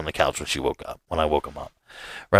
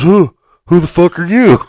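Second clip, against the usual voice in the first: higher, louder mock-defensive comeback; indignant retort; 7 semitones higher, 6 dB louder than usual. Stranger on the couch fires back at the homeowner, offended. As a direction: throw the question right back, offended.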